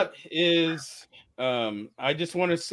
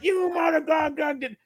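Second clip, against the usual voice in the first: angry shout; 11 semitones higher than usual. Retold or imitated. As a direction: scream it like an enraged trucker. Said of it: "he's like".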